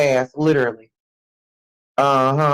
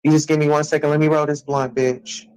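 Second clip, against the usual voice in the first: usual speaking voice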